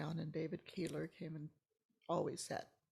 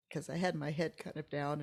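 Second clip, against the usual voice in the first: usual speaking voice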